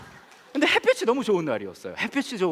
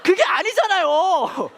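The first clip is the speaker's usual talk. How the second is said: very high, loud, drawn-out protest; indignant protest; 14 semitones higher, 8 dB louder, much slower than usual. Acted. Pastor protests his wife's kidnapper story, loud comic indignation. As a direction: cry out in comic outrage.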